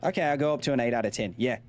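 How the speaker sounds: casual put-on Aussie accent; Australian accent. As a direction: chat away as an Australian. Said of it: "better than my Aussie accent".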